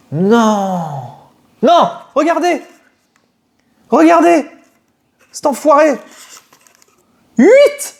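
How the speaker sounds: high, loud cries of disbelief; shocked outburst. Real, not acted. Unboxer reacting to a miscounted booster box, shocked yelling. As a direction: yell it out in sudden shock.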